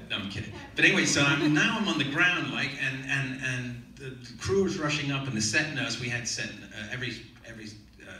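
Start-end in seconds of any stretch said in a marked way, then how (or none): none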